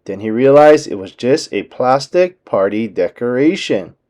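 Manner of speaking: plain reading voice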